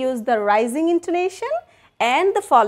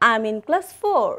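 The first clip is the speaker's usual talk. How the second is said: ordinary talk, nothing marked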